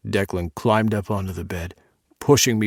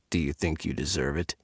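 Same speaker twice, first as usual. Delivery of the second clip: low teasing; 7 semitones lower, 7 dB quieter, faster than usual. Acted. Dominant lover questioning.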